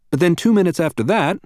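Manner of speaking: normal talking voice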